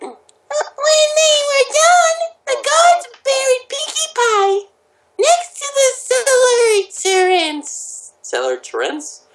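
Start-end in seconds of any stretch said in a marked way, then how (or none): 0.0-8.1 very high, slow squeaky narration; high cartoon voice. Reading fan fiction to friends, squeaky cartoon voice. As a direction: squeak it out slowly like a cartoon character.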